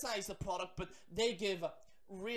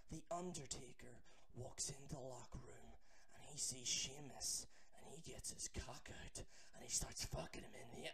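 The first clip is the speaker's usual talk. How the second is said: whispered, much softer and lower; hushed reading; 8 semitones lower, 13 dB quieter than usual. Acted. Vlogger reads the creepy fan fiction aloud in a whisper. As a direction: read it in a scandalized hush.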